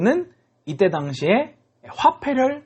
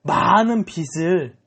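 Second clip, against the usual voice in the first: acted gush of amazed gratitude, raised at the start; overjoyed relief; 3 semitones higher, much slower than usual. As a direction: play a debtor overwhelmed with joy. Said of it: "너무 기뻐하는 거예요".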